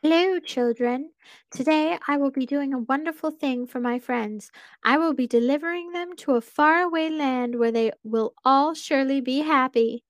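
high, muffled creepy mascot voice. Acted. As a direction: speak high through a bear mask. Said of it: "The unmistakable muffled voice spoke up".

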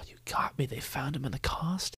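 unvoiced mock whisper; sly whispered joke. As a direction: hush it like a secret confession. Joking mock confession whisper.

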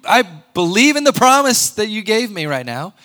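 hammed-up show of faith; mock pious prayer. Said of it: "I want to act like".

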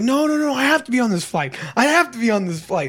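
high, insistent imitation of begging; mock desperate plea. Drunk traveler begs airline staff, high mock-desperate plea. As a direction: plead like a drunk about to be bumped. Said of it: "and he's like".

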